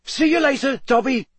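high, hurried call; shouted farewell. A narrator's reading. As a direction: call back while dashing away. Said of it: "Harry shouted".

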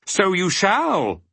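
high, slow, grand proclamation; ringing announcer-like declaration; announcing. A narrator's reading. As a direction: proclaim it with a drawn-out flourish. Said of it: "announced the dodecahedron".

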